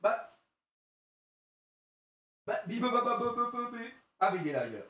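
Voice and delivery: ordinary delivery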